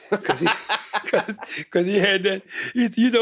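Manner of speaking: talking through laughter; laughing. Real, not acted. Laughing while telling story.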